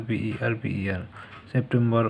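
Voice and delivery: normal talking voice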